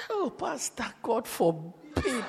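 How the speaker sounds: soft, drawn-out, confidential protest; hushed mock refusal. Brother turns down the pastor's match, hushed mock horror.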